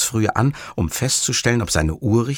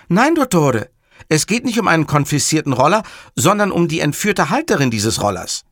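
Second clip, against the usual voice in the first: higher, insistent subordinate; urgent Fazio; 7 semitones higher, 4 dB louder than usual. Acted. Fazio explains the abduction to Montalbano, higher and urgent. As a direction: set the boss straight in a raised pitch.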